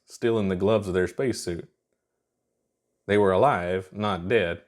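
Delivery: plain reading voice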